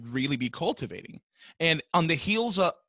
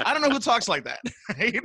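ordinary talk, nothing marked

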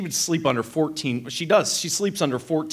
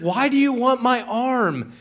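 higher, incredulous retold question; exasperated wife; 8 semitones higher than usual. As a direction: imitate a baffled wife mid-quarrel. Acted quarrel, wife's side.

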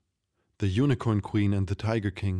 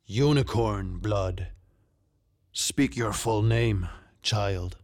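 slow, weighty command; regal, deliberate demand; Tiger King ordering; slower than usual. A narrator's reading. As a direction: draw out the order. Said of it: "the Tiger King ordered".

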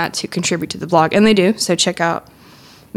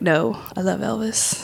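ordinary delivery

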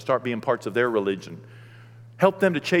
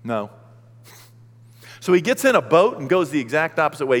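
normal talking voice

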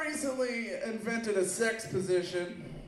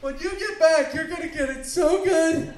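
mock seductive; 6 semitones higher, 6 dB louder than usual. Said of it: "acted promise to partner".

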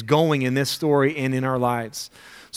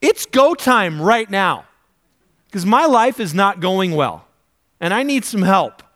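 loud, urgent demand at God; exasperated plea; 5 semitones higher, 4 dB louder than usual. Retold or imitated. Praying person snapping at God for help, loud and urgent. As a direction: push it like time's run out.

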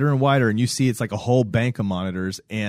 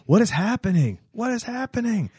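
high mock-panicked question, repeated; rising panic from a stunned man; mock freak-out, much higher; frantic panic; 11 semitones higher than usual. Frantic character retold.

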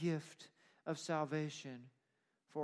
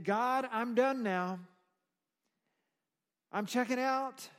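high, loud preacher speaking as a man fulfilled; emphatic declaration to God; 7 semitones higher, 7 dB louder than usual. Acted. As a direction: proclaim it upward, easing at the end. Preacher voices Simeon's emphatic words to God.

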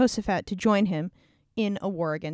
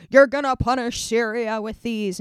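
hyped prophet voice; 5 dB louder than usual. Acted. Prophet hypes iron horns.

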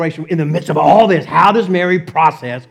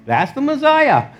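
high-pitched comic exclamation; mock awe; 8 semitones higher than usual. Joseph reacts to the wailing baby, in mock awe. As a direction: put on a high voice of wonder, playfully.

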